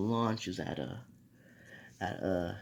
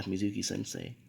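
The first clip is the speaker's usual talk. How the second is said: ordinary delivery, nothing marked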